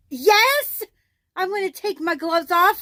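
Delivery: loud, high, sarcastic yes; exasperated retort. Retold or imitated. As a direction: fire it back, fed up.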